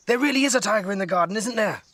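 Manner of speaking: put-on girl telling grandma, fast and thrilled; excited. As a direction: rush it out bursting with news. Nora excited at dinner.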